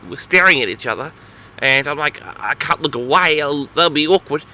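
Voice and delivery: normal talking voice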